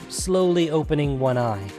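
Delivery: ordinary delivery, nothing marked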